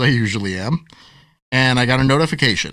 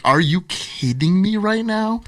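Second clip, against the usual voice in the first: slow, higher, exasperated; mock exasperation; 7 semitones higher, slower than usual. Author reacting to his ban, slow mock exasperation. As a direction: drag it out in disbelief. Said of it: "And I was just like".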